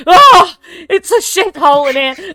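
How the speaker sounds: high, loud outraged yell; disgusted outburst. Acted. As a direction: explode in appalled disgust. Acted outburst inside the caravan.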